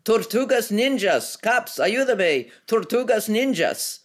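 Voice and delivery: high, loud, slow calling out "Tortugas ninjas"; shouting for help. A narrator's reading. Woman calls the street characters to her aid, shouting. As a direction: yell it across the square to rally help. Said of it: "raised her head and shouted".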